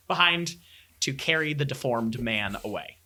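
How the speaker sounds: normal talking voice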